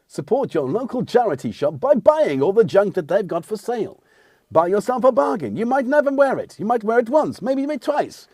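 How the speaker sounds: high-pitched, swooping promo voice; mock advert. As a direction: pitch it high like a jokey sales plug. Mock advert for charity shops.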